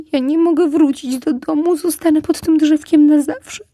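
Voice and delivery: high, loud wailing fox; lamenting. Acted. Fox wails to the squirrel that he can't go home, high and loud. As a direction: wail it like a heartbroken little fox. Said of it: "Lisek zaczął znowu lamentować".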